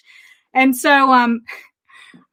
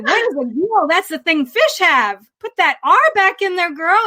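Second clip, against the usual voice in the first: higher, mock-baffled parent correcting a child; exaggerated motherly correction, drawing out the words; retold mock correction; 6 semitones higher than usual. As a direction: playfully scold the missing R, building higher. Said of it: "And I said".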